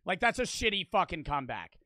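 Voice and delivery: ordinary delivery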